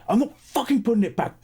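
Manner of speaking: high, angry retort; indignant high-pitched outburst; snapped. Retold or imitated. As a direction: imitate an irate stranger refusing. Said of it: "he just snapped".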